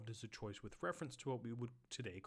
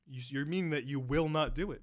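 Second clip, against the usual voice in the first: loud, stammering mock disbelief; flummoxed; 2 semitones higher, 9 dB louder than usual. Flummoxed boss questioning Bartleby, loud disbelief. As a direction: sputter it loudly, unable to believe it. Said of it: "the guy is absolutely flummoxed".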